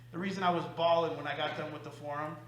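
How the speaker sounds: ordinary talk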